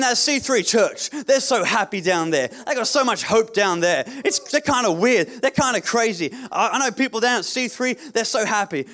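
preaching. Acted. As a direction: build it to a rousing pulpit cry. Preaching at full force.

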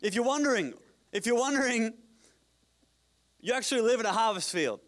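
high, ringing full-voice exhortation; preaching shout. Real, not acted. Preacher tells South Bay of its harvest field, preaching shout. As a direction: call out loudly to the whole congregation.

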